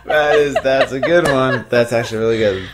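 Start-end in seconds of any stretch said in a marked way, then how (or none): 0.0-1.7 real laughter through the words, louder; can't stop laughing at the punchline. Real, not acted. Host reacting to the guest's joke, laughing loudly.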